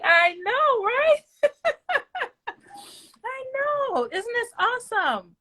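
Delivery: squealing high with a laugh; gushing. Real, not acted. Mom answering her kids' praise, squealing with joy. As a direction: gush back, near tears of joy.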